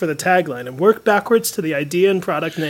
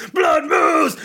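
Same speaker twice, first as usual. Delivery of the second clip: shouted band name; 8 semitones higher than usual. Pretend. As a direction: yell it like a rock announcer. Announcing metal band.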